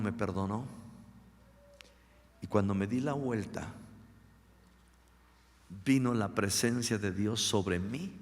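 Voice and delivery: ordinary delivery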